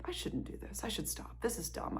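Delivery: the nagging voice in your head; whispered inner voice. Retold or imitated. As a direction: whisper the doubts to yourself. Inner voice during hard run.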